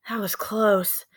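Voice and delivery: breathless relieved whisper. Acted. Jack relieved after the escape, whispered. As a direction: let the relief out in a hush.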